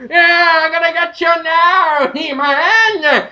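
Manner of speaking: high, slow villain screech; Skeletor. Acted. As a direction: screech the threat like a cartoon skeleton. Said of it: "Skeletor's like".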